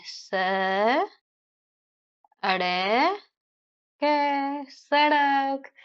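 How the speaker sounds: स, अड़े, कै each held long, then सड़क stretched; sound by sound. Shown as a demonstration. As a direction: chant out स, अड़े, कै slowly as if writing, ending on a drawn सड़क. Sounding out while writing.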